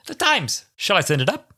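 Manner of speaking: high, mock-announcing character voice; acted-out comic gag. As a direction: call it out high, hamming up the scene. Re-enacting the gag from the episode.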